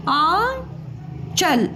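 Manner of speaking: slow sounding out. Shown as a demonstration. Teacher sounding out a word.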